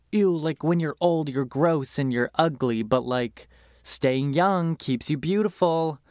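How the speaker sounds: high, disgusted voice of a vain snob; a hint of a snooty beauty-obsessed woman; mock disgusted vanity. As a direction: sneer at being old, then coo about staying young. Mocking the film's beauty satire.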